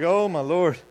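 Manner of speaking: loud mock exasperation; exasperated. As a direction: sigh it out in comic despair. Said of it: "And I was like".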